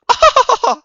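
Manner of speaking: loud, very high-pitched laugh; high cackle. Acted. Streamer playing a TV pundit, sudden high cackle. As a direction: burst into a piercing high cackle. Laughs inside Tucker Carlson impression.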